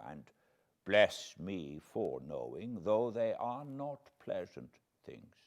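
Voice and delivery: softly tender old professor, lightly voiced; infinite tenderness. A narrator's reading. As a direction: soothe a grieving friend gently. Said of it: "with infinite tenderness".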